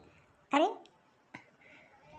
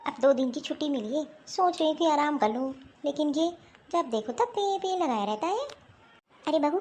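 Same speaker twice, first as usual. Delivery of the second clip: ordinary delivery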